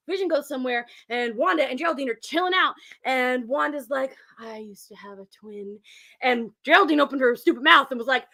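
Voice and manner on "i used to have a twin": low, slow, very soft confession; wistful. Retold or imitated. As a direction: murmur it slowly as a mournful memory. Acting wistful Wanda remembering brother.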